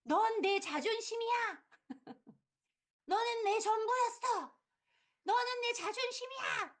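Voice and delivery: cling to the son as pride; possessive mother. Retold or imitated. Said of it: "그 엄마는 그 얘기 하잖아요". (아들에게 집착하는 엄마처럼 높고 느리게)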